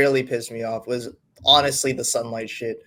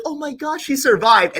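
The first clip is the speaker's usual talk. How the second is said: high, mock teary gasp of relief; imitating emotional wife; 8 semitones higher than usual. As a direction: play the wife's gushing relief, lightly. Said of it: "'cause she's like".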